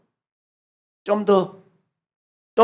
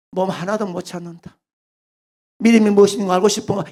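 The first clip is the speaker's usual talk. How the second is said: ordinary talk, nothing marked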